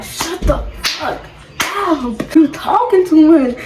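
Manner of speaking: high, drawn-out exasperated retort; getting mad. Retold or imitated. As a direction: replay a fed-up outburst, slow and high. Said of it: "I started getting mad because".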